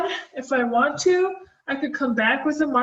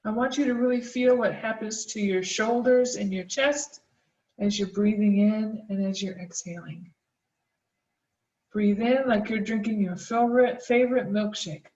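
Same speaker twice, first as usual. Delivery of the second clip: guided breathing; 4 dB quieter than usual. Acted. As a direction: calmly lead the breathing exercise. Leads a snake breath mindfulness exercise.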